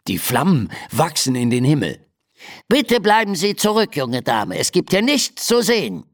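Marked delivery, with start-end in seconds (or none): 2.7-6.0 raised-pitch little official; mouse captain calling. Acted. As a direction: call up officiously as a tiny fire captain. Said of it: "ruft der Mäusefeuerwehrhauptmann zu ihr hoch". (hoch rufender Mäusehauptmann)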